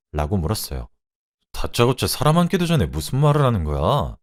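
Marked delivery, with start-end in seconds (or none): 1.5-4.2 higher-pitched indignant denial; flat-out denial. Acted. Husband confronted by his wife about cheating, indignant denial. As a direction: deny it with an affronted rise. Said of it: "남편은 딱 잡아떼더군요".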